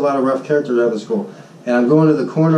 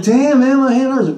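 mock pained gripe; 11 semitones higher, 3 dB louder than usual. Complaining after the fight.

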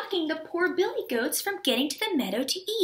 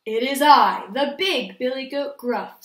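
booming loud announcement; loud big-goat voice; 2 semitones lower, 8 dB louder than usual. A narrator's reading. Big goat answering the troll, very loud. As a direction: announce yourself as loudly as a troll. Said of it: "as loud as the troll's".

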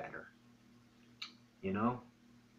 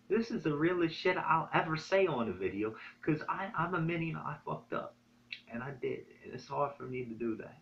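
emotional; 6 semitones higher than usual. Real, not acted. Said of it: "I'm sorry if I'm getting emotional here".

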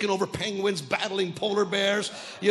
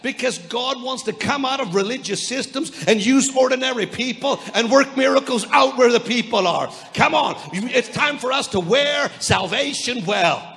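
preaching at full force; 3 semitones higher, 7 dB louder than usual. Acted. Preaching climax.

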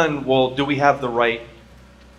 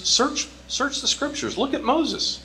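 Jesus voice; 12 semitones higher, 4 dB quieter than usual. Retold or imitated. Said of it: "he goes".

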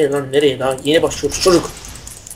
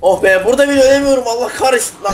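frustrated; 8 semitones higher than usual. Real, not acted. Can't die even here, frustrated.

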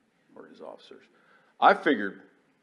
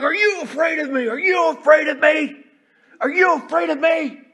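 furious yell; 13 dB louder than usual. Retold or imitated. Enraged commander yelling at his officers, loud bellow. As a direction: roar it at the room like a raging commander. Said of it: "he's yelling and he's cussing".

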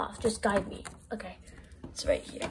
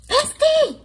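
loud, high call across the room; 8 dB louder than usual. Pretend. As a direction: shout for your friend from afar. Calling friend from the shower.

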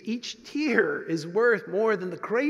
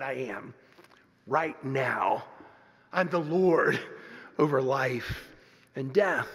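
voice of Jesus; 7 semitones lower, slower than usual. Acted. Preacher voicing Jesus' claim.

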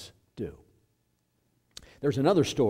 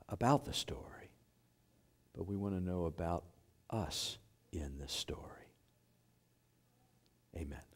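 prayer; 4 semitones lower, 7 dB quieter than usual. Real, not acted. Preacher prays over the congregation before the sermon, hushed prayer. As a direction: pray quietly, in short phrases with space between.

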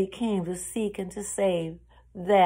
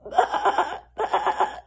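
weakened high bleat; sore-throated lamb; 10 semitones higher, 4 dB louder than usual. A narrator's reading. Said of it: "Her throat was sore".

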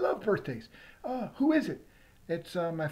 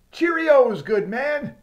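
loud higher hammed-up Brit; mock British punchline; 6 semitones higher, 12 dB louder, much slower than usual. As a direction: bellow it like a jolly old Englishman.

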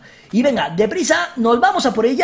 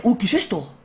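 ordinary talk

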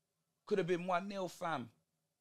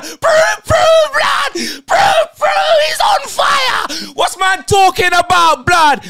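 ecstatic, much higher and louder screaming; goal celebration; 7 dB louder, slower than usual. Real, not acted. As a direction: scream it like your team just scored.

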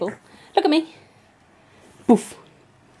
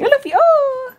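high cooing; 3 dB louder than usual. Real, not acted. Cooing at the puppy.

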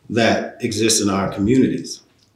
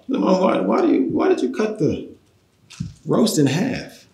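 raised pitch, puzzled asking; high questioning voice; 8 semitones higher than usual. Retold or imitated. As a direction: ask it up high, slowly. Son-in-law asking mother-in-law.

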